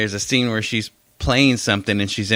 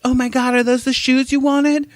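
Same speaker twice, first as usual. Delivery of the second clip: put-on girly commercial voice; 4 dB louder than usual. Mock girl in commercial.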